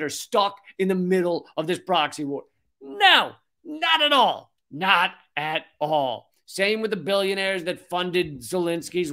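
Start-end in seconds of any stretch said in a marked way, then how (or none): 2.8-4.5 very high, drawn-out falling no; emphatic denial. Acted. Answers own rhetorical question emphatically. 4.7-6.3 slow, spaced-out NOT AT ALL; deliberate denial. Acted. Repeats denial slowly, in lower voice.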